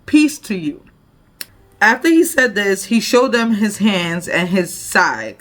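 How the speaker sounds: scripture reading. Acted. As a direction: proclaim the verses to the congregation. Reads scripture aloud.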